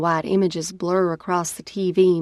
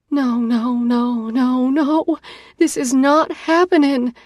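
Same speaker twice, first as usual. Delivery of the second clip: rising, louder panic; panicked; 7 semitones higher, 5 dB louder than usual. Acted. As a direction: spiral into panic, higher each no. Panicking over pregnancy news.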